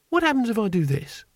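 high, innocent question; naive curious voice. A narrator's reading. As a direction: ask it like a curious child. Naive quote in narration.

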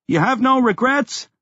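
shouted question. A narrator's reading. Talk shouted over the quake.

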